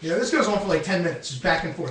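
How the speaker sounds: usual speaking voice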